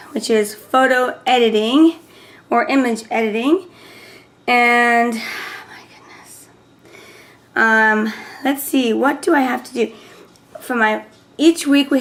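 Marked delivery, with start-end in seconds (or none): 5.1-6.5 whispered aside. Real, not acted. Sighing aside.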